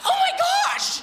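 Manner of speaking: high, loud, laughing "oh my gosh"; laughing amazement. Retold or imitated. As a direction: squeal it out through a laugh. Andrew amazed at answered prayer.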